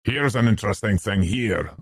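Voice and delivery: determinedly entertaining announcement; valiantly entertaining. A narrator's reading. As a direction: try hard to interest a bored listener. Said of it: "in the manner of one endeavouring valiantly to entertain".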